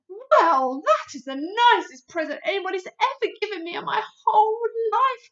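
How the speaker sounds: loud happy giant voice; a giant thanking warmly; BFG, touched. Acted. BFG voice in story.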